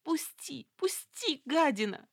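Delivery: high terrified shriek; frantic pleading scream. A narrator's reading. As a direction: fight free, screaming in panic. Hostage struggling, begging release. (испуганный визг)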